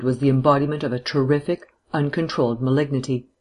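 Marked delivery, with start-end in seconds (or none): none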